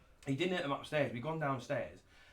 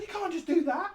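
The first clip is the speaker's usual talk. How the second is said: quoting ex; 5 dB louder than usual. Retold or imitated. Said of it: "She said".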